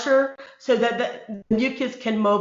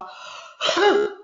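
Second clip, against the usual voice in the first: huff cough; 9 semitones higher than usual. Shown as a demonstration. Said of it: "use a big huff cough".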